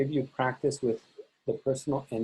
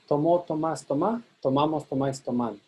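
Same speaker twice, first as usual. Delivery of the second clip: "tomo, tomas, toma, toman" each stressed on the last syllable; stress on endings. Shown as a demonstration. Teacher imitates students' incorrect pattern, endings stressed from "tomo" to "toman". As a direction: run the list leaning on each ending. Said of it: "That would be incorrect".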